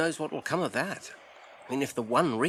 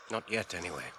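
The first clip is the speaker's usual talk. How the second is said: ordinary delivery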